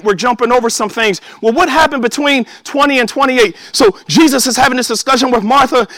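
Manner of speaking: ordinary delivery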